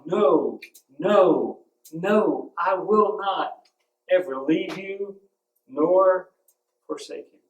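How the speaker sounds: "no, no, no" punched, then "I will not ever" drawn out slowly and high; hammering it down. Shown as a demonstration. Preacher hammers God's promise to the congregation, slow and emphatic. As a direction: pound every "no" like a hammer. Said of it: "God's just hammering that down".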